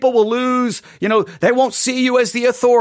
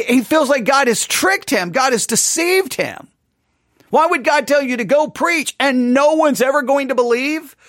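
usual speaking voice

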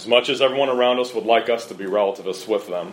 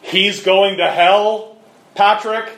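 high, loud, drawn-out accusation; imitating a woman's loud, indignant question, climbing in pitch; very loud challenge; 7 semitones higher, 4 dB louder, slower than usual. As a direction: call it out so the whole room hears. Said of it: "she said very loudly".

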